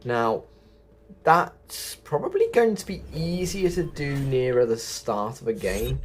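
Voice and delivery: ordinary talk, nothing marked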